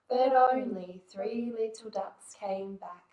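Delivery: plain reading voice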